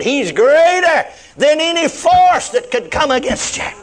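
high, building preacher's cry; exultant pulpit proclamation; triumphant climax. Real, not acted. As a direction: let it soar to a peak. Preacher's climax of a repeated list.

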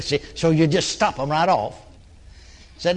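ordinary talk

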